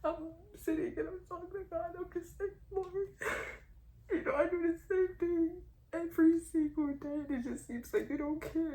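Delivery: crying. Real, not acted. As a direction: plead through real tears. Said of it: "This is already my worst".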